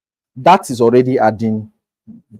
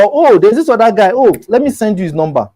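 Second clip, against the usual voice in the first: eager helpful client; 8 semitones higher, 3 dB louder than usual. Retold or imitated.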